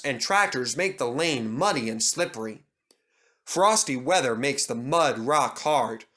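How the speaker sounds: ordinary delivery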